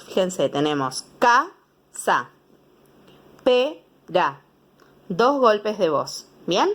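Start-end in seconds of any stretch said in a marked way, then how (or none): 1.2-4.4 ca, sa, pe, ra, each syllable said apart; two beats each. Shown as a demonstration. Teacher to pupils, showing two beats, syllables apart. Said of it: "pronuncialos en dos golpes de voz".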